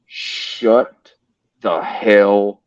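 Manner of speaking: slow, word-by-word stern order; imitating a mad friend, controlled and emphatic; retold anger. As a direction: separate each word like a dad at his limit. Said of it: "only time I've ever heard him mad".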